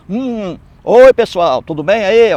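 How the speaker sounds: mock the cured mute suddenly greeting everyone; hammed-up cheerful greeting; healed mute speaking. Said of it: "o mudo falando".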